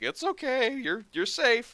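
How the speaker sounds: high, soothing comfort to a crying woman; reassuring. Retold or imitated. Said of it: "and I'm like".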